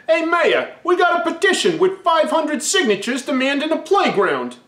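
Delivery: put-on high, eager kid voice bursting in; excited kids. Kids rushing into the council meeting, high and excited. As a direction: burst in thrilled with news.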